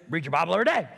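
put-on parroting voice; obnoxious mocking. Answering a friend's question with a teasing catchphrase. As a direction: needle him with the catchphrase. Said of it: "in response to him telling me not to mock him".

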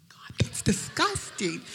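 a laughing whisper of disgust. Retold or imitated. Girlfriend in his smelly house, whispered disgust. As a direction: whisper it so he won't hear.

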